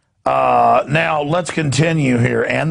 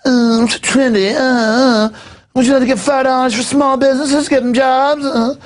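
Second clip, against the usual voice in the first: high, loud put-on hipster voice; mock trendy barista; 10 semitones higher, 3 dB louder than usual. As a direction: ham up a preening barista. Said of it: "Sure, I'll do one for people".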